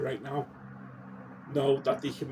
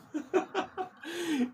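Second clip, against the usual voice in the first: self-conscious laugh. Real, not acted. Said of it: "I'm getting all worked up now".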